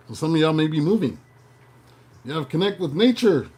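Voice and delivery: hammed-up horoscope read, slowing on the big news; horoscope reader impression. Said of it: "I was getting my Tony Baker on".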